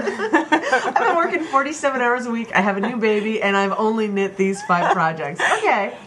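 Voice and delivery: high, loud laughing through the words. Real, not acted. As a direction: laugh while listing it all. Laughing at her own busy life.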